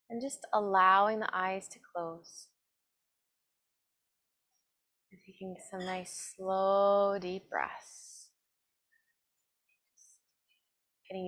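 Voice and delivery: slow, calm meditation voice with long pauses; guided meditation. Acted. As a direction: guide them gently inward, evenly and unhurried. Leads a seated meditation.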